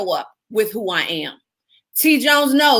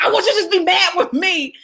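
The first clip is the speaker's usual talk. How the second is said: much higher, laughing exasperation; laughing plea; 8 semitones higher than usual. Retold or imitated. Pleading with her calm attorney, laughing and higher. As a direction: beg him to get mad, laughing. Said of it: "'cause I'm like".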